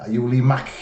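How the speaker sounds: imitated Scouse with a scraped K on "Mack"; Scouse accent. Shown as a demonstration. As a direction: mimic a Liverpudlian's throaty ending. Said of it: "put that little extra bit onto it".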